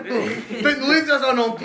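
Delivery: laughing. Real, not acted. Joking about the paté.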